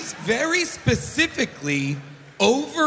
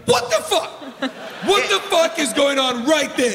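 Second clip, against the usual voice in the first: shocked outburst; 5 semitones higher than usual. Retold or imitated. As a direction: blurt it out in total shock. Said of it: "Everybody there should be like".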